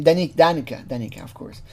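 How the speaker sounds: ordinary delivery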